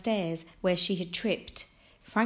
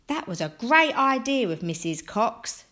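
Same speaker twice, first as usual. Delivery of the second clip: put-on higher, louder boyish enthusiasm; excited; 5 semitones higher, 6 dB louder than usual. A narrator's reading. Josh talking happily to Franklin, excited. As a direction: praise it with delight. Josh cheerfully praises the happy solution.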